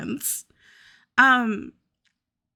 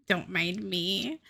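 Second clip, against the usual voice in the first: usual speaking voice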